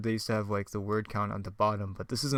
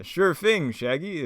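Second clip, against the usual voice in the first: higher, louder cartoon leader voice; Fred; 6 semitones higher, 4 dB louder, slower than usual. Fred accepting Shaggy's help, raised put-on voice. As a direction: answer in a hammed-up agreeable voice. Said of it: "Fred's actually like".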